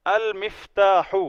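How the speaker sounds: المفتاح drawn out slowly with a falling end; careful Arabic reading. Shown as a demonstration. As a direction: say المفتاح slowly, al-miftah, then let it fall. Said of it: "اب اس کو المفتاح ہی پڑھیں گے".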